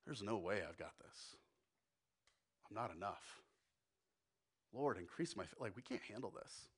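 soft, low, defeated murmur; a man overwhelmed by the task, voice sinking; despairing Thomas. Acted. Thomas looks at his hands and admits defeat, soft and low. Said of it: "just going".

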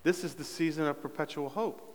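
soft, understated delivery of a stock line; imitating his catchphrase. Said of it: "I'll say".

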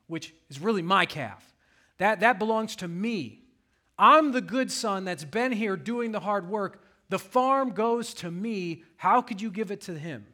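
put-on indignant older son. Older son protesting to his father, loud and high. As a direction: claim what's yours with rising outrage. Pastor voices older son's grievance.

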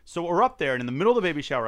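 ordinary talk, nothing marked

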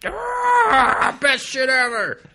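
very high, loud, slow squealing shout; fanboy squeal. Pretend. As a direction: scream it like a hyped fan. Parodying hyped fanboy.

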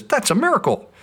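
high, amazed cry of wonder; amazed dog. Retold or imitated. Dog amazed the weather report was right, high amazed exclamation. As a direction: gasp it out like a stunned dog. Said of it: "and the dog's amazed".

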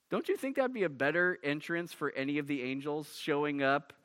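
normal talking voice

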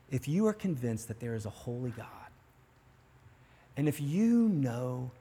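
softly pleading to the guilty listener; hushed preaching. Real, not acted. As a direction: lean in and bring it down low for the convicted. Preacher drops voice on sin.

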